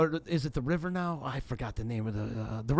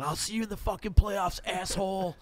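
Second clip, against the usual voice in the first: higher-pitched mock yell; half-volume replay of a buddy's heckle; retold holler, not at full volume; imitated yelling; 6 semitones higher than usual. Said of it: "Alex just yelling".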